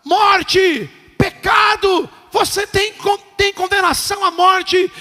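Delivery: loud, high accusing shout; condemning religion. Acted. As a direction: hurl the verdict. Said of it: "A religião gera culpa e fala". (voz acusadora gritada)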